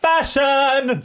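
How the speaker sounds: high, loud shout of joy; excited. Real, not acted. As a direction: yell it out with delight. Bursting out favorite feature.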